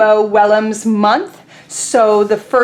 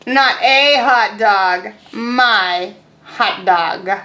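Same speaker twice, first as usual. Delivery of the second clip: very slow, stressed MY; possessive pigeon; 2 semitones lower, much slower than usual. Acted. Teacher voicing Pigeon guarding his hot dog, very slow. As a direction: drag it out, claiming the hot dog. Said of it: "says Pigeon".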